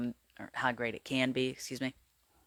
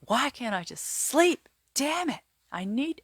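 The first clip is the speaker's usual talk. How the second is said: much higher, pleading exasperation, slow at first; a sleepless person cracking with frustration, much higher pitch; frustrated at not sleeping; 12 semitones higher than usual. Retold or imitated. Lying in bed unable to sleep, a much higher frustrated whine. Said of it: "And you're just like".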